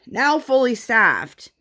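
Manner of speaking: slow, high-pitched proclamation; sign announcement. Retold or imitated. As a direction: proclaim it like a banner, drawn out. Reads out restaurant's boastful sign.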